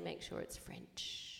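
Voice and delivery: slow whispered aside. Real, not acted. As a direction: whisper it slowly like a secret. Whispered punchline.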